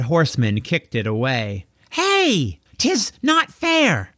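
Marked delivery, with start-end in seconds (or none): 1.9-4.1 high, stretched-out cry of unfairness; indignant young hero, higher; indignant boy protest. Acted.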